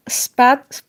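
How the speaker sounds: ordinary talk, nothing marked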